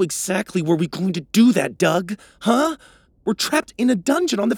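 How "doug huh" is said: ordinary delivery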